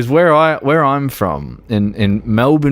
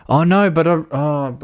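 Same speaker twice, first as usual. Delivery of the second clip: ordinary talk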